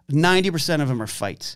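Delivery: ordinary talk, nothing marked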